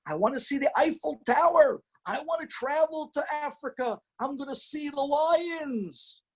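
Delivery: high, eager list of dreams, slowing at the end; dreaming wife. Acted. Wife dreaming of trips with her husband, high and eager. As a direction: reel off every dream trip, high and eager.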